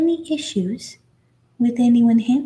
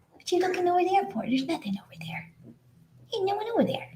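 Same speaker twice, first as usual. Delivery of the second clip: high teasing coo; 8 semitones higher, 7 dB quieter, much faster than usual. Real, not acted. Teasing puppy.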